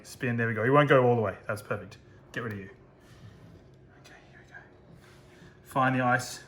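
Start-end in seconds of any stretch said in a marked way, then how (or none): 4.0-4.6 soft whispered focus. Real, not acted. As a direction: whisper while concentrating. Concentrating on delicate step.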